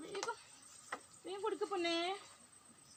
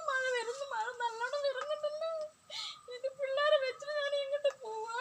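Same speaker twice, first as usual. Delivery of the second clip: sobbing, raised pitch, louder; tearful wailing; 3 semitones higher, 3 dB louder than usual. Acted. Homeless mother crying to her own mother, sobbing and loud. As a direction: weep out the helpless questions.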